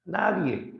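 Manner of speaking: Polifemo agudo. Acted. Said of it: "quién te hace daño".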